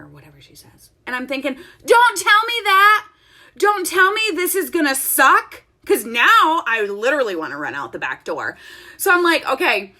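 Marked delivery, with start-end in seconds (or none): 1.8-5.6 dismayed protest. Retold or imitated. Student dreading the hardest pose, dismayed protest. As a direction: plead it loudly in dread. Inner protest at instructor's warning.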